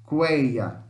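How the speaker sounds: Cueia with the vowels run together in one syllable; demo pronunciation. Shown as a demonstration. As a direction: say Cueia with all vowels joined. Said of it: "tudo junto". (pronúncia de Cueia, tudo junto)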